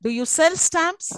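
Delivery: slow, high "Do you sell stamps", going up; high rising question on "stamps". Shown as a demonstration. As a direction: climb slowly to a high "stamps". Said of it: "it's quite high".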